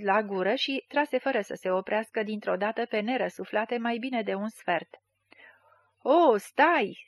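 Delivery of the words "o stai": higher, louder cry to stop; shout. A narrator's reading. Schemer tries to stop the drinker, shouting. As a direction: call out to halt him. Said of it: "strigă Panteră".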